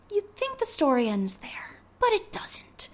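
whispered teaser, breathy and hushed. Real, not acted. Teasing the podcast listeners with a hushed twist.

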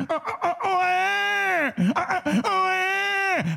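hammed-up infant cry for the breast; baby wail. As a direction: wail like a hungry baby at night. Imitates baby crying for milk.

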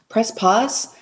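ordinary talk

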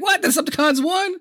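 high, incredulous squeak; mock disbelief. Kid stunned that the villains won, high shocked voice. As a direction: gasp out the question in disbelief. Kid reacting to movie intro.

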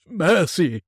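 high desperate cry for mercy; terrified plea. Acted. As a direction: beg for your life. Scrooge on his knees begging.

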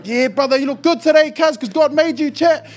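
high, loud self-hype; mirror hype voice. Pretend. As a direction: pump yourself up in the mirror. Hyping himself in the mirror.

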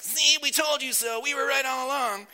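high, smug whiny gloat. Acted. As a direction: gloat like a sulky know-it-all. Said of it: "that's my whiny voice".

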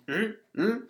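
high, soft closed-mouth hum; disapproving murmur. Acted. Podcaster imitating Marge at the wedding, disapproving high hum. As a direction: imitate a worried wife's rising murmur.

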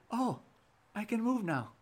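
lizard's high-pitched little discovery; mock surprise. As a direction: act pleasantly amazed, voice lifted well up.

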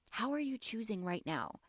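ordinary talk, nothing marked

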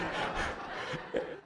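breathy little laugh; a soft laugh at the memory. Real, not acted. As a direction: laugh quietly to yourself. Recalling a funny moment.

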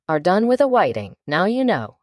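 plain reading voice

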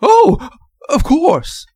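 high, drawn-out hysterical outburst; frantic nurse, loud and very high; hysterical cry. A narrator's reading. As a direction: cry out in a high-pitched panic. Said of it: "she cried hysterically".

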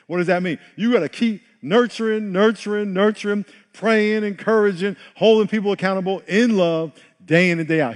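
full-force preaching. Acted. Pastor urging the congregation to keep nurturing, climbing and loud. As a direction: preach it hard, repeating each word.

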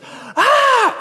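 retold scream of terror. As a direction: shriek at the spiders overhead. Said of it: "she looks up and she goes".

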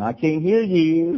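playful sing-out, pretending not to hear; childish refusal to listen. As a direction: stretch it out like a child blocking ears. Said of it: "you feel like saying".

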